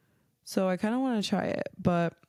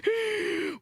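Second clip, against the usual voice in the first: laugh breaking through. Real, not acted. Breaks up mid impression.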